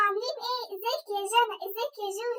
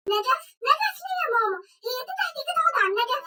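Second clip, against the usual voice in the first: loud protest; 3 semitones higher, 4 dB louder than usual. Acted. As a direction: protest loudly in disbelief. Kid loudly protests the chandelier.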